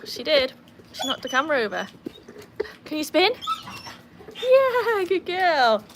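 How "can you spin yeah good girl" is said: high, loud sing-song praise for the dog; doggy talk. Real, not acted. Owner praising her dog during a trick. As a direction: coax the dog up high and bright.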